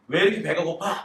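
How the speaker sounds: usual speaking voice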